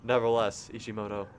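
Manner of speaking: ordinary delivery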